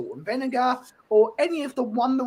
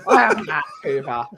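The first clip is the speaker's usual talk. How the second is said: mock mad; 8 semitones lower, 5 dB louder than usual. Real, not acted. Playful retort.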